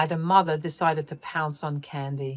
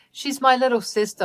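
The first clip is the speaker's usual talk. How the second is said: ordinary delivery, nothing marked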